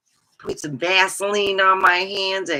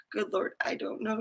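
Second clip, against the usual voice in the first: hushed reply; 3 semitones higher, 11 dB quieter, faster than usual. Retold or imitated. Answering Mike's question.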